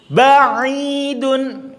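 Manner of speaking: Ba'idun chanted high and drawn out with clear lips; model word. Shown as a demonstration. Teacher models Ba'idun for the class.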